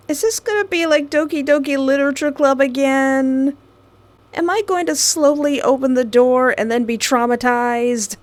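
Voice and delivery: hyped-up worry about a jump scare; excited dread. Real, not acted. Gamer reacting to spooky setup.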